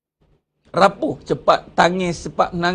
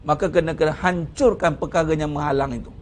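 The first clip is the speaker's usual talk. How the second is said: ordinary talk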